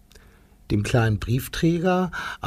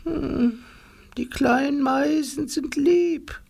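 put-on sad old granny; 11 semitones higher than usual. Oma Rumpelputz gently to the neighbours, high and sad. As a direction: speak like a kind grandma smiling sadly. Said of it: "lächelte mit traurigen Augen".

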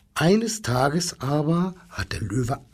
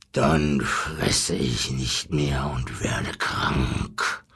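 very low, slow lion voice; deep, drawn-out animal growl; 12 semitones lower, slower than usual. Acted. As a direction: rumble a sulky threat as a lion. Lion threatens the zookeeper.

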